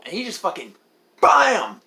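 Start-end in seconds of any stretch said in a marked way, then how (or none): 1.2-1.8 loud, high burst on "bam"; exclaimed impact. Retold or imitated. Narrator reenacting the movie fight, loud exclamation. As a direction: punch it out like a hit landing. Imitates the blow in retold fight.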